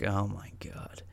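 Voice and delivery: slow, hushed mock dismay; stunned whisper. Retelling his reaction to panicking friend, stunned slow whisper. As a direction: breathe it out slowly in a whisper.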